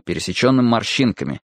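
usual speaking voice

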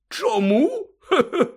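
high, loud, chuckling disbelief; boozy astonished character voice; surprised Hagrid. A narrator's reading. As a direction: puzzle aloud like a tipsy friend. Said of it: "здивувався Гегрід".

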